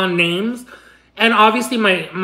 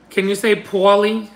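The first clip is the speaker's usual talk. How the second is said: ordinary talk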